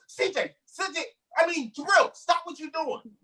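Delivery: high mock scolding; frustrated dad. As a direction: play the old man mixing up names, annoyed. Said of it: "My dad gets mad at him".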